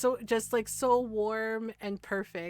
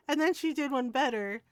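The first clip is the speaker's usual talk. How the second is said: ordinary talk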